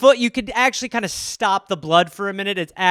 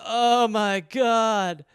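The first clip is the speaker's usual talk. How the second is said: very slow, pained "Oh my God"; in nauseous pain on the pillows; drawn-out groan; 4 semitones higher, much slower than usual. Retold or imitated. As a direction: moan it out in slow agony. Lying sick after hit in balls.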